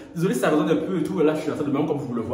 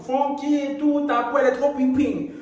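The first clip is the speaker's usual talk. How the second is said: quoting a fan; 7 semitones higher than usual. Retold or imitated. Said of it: "elle vous montre".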